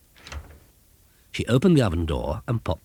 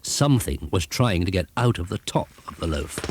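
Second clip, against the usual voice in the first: plain reading voice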